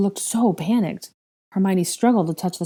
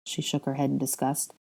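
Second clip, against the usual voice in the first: usual speaking voice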